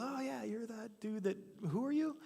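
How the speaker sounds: pitched far up, vague and unsure; a king who can't quite place the face; puzzled king. Retold or imitated. King Saul fumbling to recognize David, high puzzled voice.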